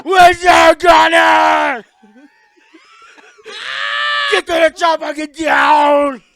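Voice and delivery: high, loud robotic shout; Terminator voice. Pretend. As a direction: bark it as the Terminator. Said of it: "it is the Terminator".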